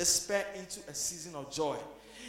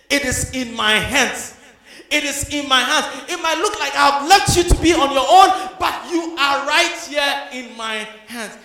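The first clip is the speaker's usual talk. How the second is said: louder and louder, ending in a shout; shouted preaching climax; 6 semitones higher, 15 dB louder than usual. Acted. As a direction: build the refrain to a shouted peak. Preaching climax, repeated refrain.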